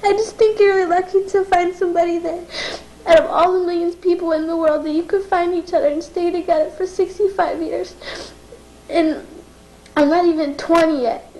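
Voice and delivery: pushing words out through real tears; crying. Real, not acted. Granddaughter thanking grandparents on their anniversary, crying. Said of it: "I don't know why I'm crying".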